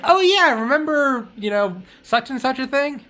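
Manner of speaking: high, loud "oh yeah" of sudden recall; a light send-up of a foggy memory; mock reminiscing. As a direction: play someone who half remembers old news. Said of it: "and you're like".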